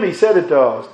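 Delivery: usual speaking voice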